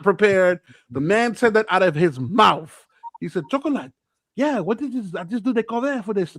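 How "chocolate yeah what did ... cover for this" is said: put-on weird, higher French-Brazilian accent. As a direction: imitate a French-speaking Brazilian acquaintance. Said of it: "a weird French Brazilian accent".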